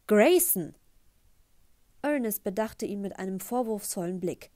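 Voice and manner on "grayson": loud reproachful call of the name; father scolding his son at the table; sharp rebuke. Acted. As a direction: snap his name to stop him.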